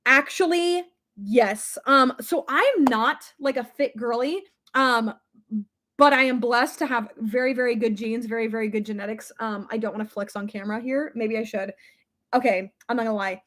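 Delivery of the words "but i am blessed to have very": ordinary delivery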